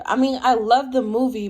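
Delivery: ordinary talk, nothing marked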